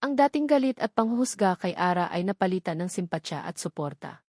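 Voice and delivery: usual speaking voice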